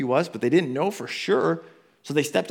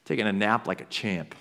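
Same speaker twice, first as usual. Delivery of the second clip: usual speaking voice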